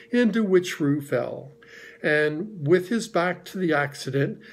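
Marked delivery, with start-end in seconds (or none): none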